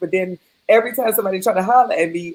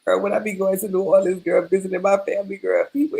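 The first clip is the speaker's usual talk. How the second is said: ordinary talk, nothing marked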